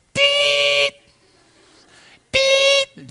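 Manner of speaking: sifflement imité, très aigu; coup de sifflet imité. Retold or imitated. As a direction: imiter le petit sifflet de la chaudière orange. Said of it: "je souffle". (sifflet imité très aigu)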